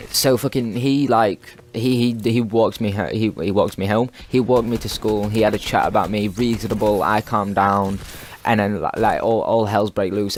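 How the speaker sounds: ordinary talk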